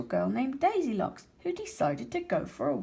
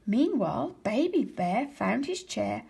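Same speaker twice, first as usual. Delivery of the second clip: ordinary delivery, nothing marked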